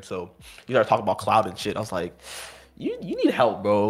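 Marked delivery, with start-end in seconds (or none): 2.8-3.9 mock concern. Mocking the raging opponent.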